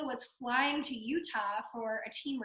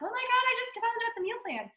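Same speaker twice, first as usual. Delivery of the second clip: panicked, much higher mock squeal; freaking out; 11 semitones higher, 6 dB louder than usual. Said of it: "freaking out".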